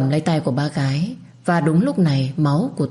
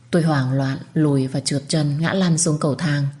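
plain reading voice

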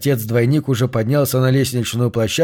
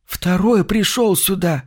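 slow, hushed, high-pitched fear; frightened whisper; 6 semitones higher, slower than usual. A narrator's reading. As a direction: breathe it out in terror. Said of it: "прошептал Чарльз".

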